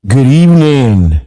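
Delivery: announcer voice. Acted. Radio host's mock evening greeting.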